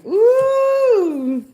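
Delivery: loud, high excited cheer; excited whoop. Real, not acted. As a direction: cheer it out with joy. Excited at number sign.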